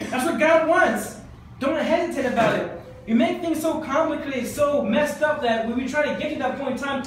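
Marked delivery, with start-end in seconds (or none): 0.1-3.8 high, loud, laughing exhortation; preacher rallying hesitant Christians, fired up; fired-up preaching. Acted. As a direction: urge them to jump in.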